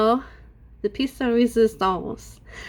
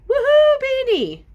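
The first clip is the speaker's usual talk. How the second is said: high, loud cheering woohoo; mock cheer; 4 dB louder than usual. Mocking fans who cheer her weight loss, shouted woohoo. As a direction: cheer like a crowd for Beanie. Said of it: "Before you get yourselves".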